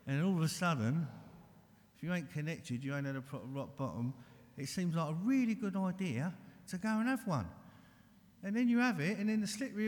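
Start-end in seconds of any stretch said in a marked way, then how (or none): none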